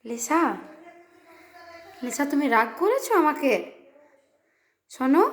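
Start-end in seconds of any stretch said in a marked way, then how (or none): 0.0-0.4 low, soft, slow fond teasing; tender teasing. Real, not acted. Mother fondly teases her sulking daughter, low and slow. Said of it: "কত অভিমান মেয়ের আমার".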